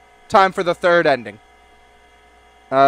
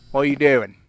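ordinary delivery

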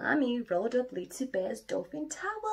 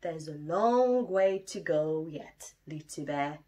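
Daddy Bear; 4 semitones lower, 5 dB louder than usual. Acted. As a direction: answer low and patient. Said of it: "Daddy laughed and said".

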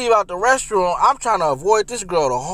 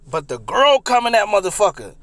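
normal talking voice

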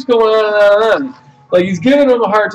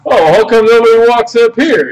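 louder, higher imitation of an arguing customer; mock indignant protest; 5 semitones higher, 5 dB louder than usual. Retelling the line jumper arguing with checkout lady, mock indignant. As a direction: mimic his indignant objection, raised and loud.

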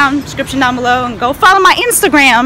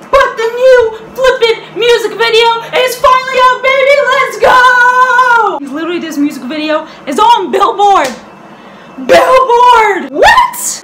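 excited; 7 semitones higher than usual. Real, not acted. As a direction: burst out with fan excitement. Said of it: "I'm super excited".

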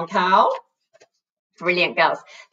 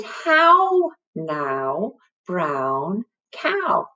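each word drawn out, HOW raised; slowly modelled rhyme; much slower than usual. Shown as a demonstration. Teacher introduces the little rhyme, slow and drawn out. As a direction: stretch out "How now, brown cow" word by word.

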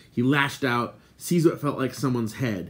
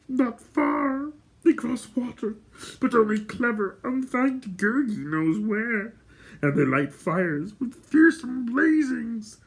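high-pitched creature voice, drawn out; Gurgi's whine; 13 semitones higher, slower than usual. A narrator's reading. Gurgi telling Gwydion where they are, whining. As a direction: tell it in a high, plaintive creature voice.

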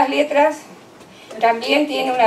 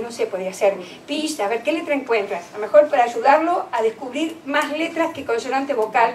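normal talking voice

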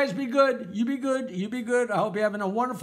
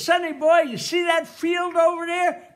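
put-on higher, louder old-timer calling to his grandson; grandpa voice; 7 semitones higher, 6 dB louder than usual. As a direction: play a grandpa pointing out the sights. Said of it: "And Grandpa says".

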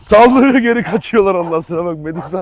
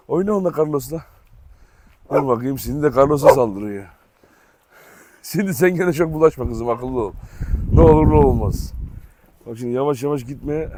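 ordinary talk, nothing marked

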